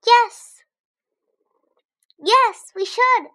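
very high, loud hamster squeaks; squeaking. A narrator's reading. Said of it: "I squeaked".

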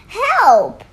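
loud high cry for help; shouted. Acted. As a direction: yell for help. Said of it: "they all shouted".

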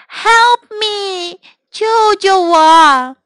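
high tearful call for help; cry for help. Acted. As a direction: wail for rescue. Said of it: "cry for help".

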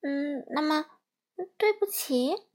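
put-on a hesitant child guessing, high; tentative, questioning guess; unsure. A narrator's reading. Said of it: "佩佩还是不敢肯定". (不敢肯定地猜)

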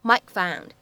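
"Mike found" with the k held, not released; incomplete plosion. Shown as a demonstration. Teacher models "Mike found" with incomplete plosion for students. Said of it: "我们呢可以读成是Mike found".

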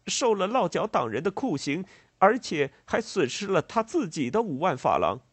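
high, loud, agitated farmer voice; aggrieved farmer. A narrator's reading. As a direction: plead your losses with rising indignation. Farmer pleads for compensation. (农人激动委屈地高声诉说=)

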